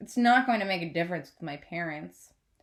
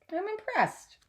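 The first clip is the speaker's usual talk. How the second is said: lightly voiced mom; 12 semitones higher, slower than usual. A narrator's reading. Mother voiced in story.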